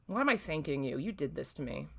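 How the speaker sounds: ordinary talk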